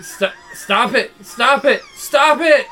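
halting plea rising to a high shout; stammer, then scream it out; building to a scream. Acted. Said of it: "He's saying".

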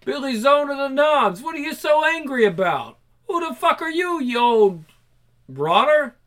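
lightly voiced sneering punk; rowdy punk. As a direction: heckle like a drunk punk. Punk rowdily confronting others.

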